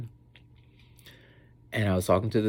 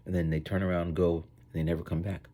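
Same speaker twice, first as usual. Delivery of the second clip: ordinary talk, nothing marked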